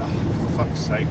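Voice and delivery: low, slow, sighed groan of disbelief; weary exasperation. Retold or imitated. Viewer reacts to football punditry, low weary exasperation. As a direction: sigh it out, utterly fed up.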